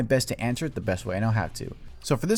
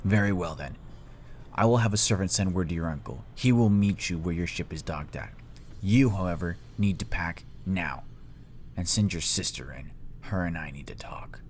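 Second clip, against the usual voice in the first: lower, measured voice of authority; stern Fire Lord father; 4 semitones lower, 4 dB quieter than usual. Acted. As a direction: dismiss him coolly, sinking softer at the end. Voices father dismissing his son.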